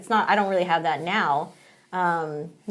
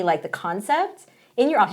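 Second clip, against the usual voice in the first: ordinary delivery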